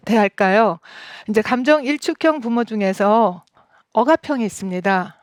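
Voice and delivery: ordinary delivery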